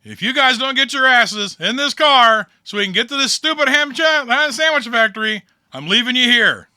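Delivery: loud, high, fed-up shout from the car roof; yelling limo driver. Acted. Limo driver yelling at the party from the roof, loud. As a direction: bark at them to get in the car.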